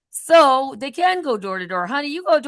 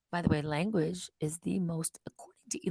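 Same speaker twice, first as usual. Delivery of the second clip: whispered aside; 7 semitones lower, 10 dB quieter than usual. Real, not acted.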